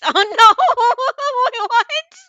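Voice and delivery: high, loud laughing "oh no, what"; laughing loudly. Real, not acted. Streamer reading a chat joke, bursting into loud laughter. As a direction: crack up at the chat, then blurt through the laugh.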